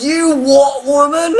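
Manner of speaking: high, loud mock rage from a woman on gas and air; raging mum. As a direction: shriek it like a furious, woozy new mum. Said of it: "She was raging".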